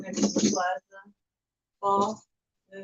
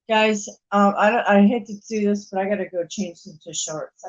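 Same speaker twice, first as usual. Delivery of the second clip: ordinary delivery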